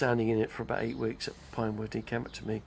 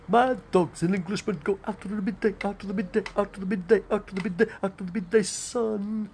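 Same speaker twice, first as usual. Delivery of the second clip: high, rhythmic, affected English patter; Noel Coward; 8 semitones higher, 4 dB louder than usual. Acted. Mimicking a stage wit's repeated refrain, high affected patter. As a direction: rattle it out high, stretching the last words. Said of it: "like Noel Coward".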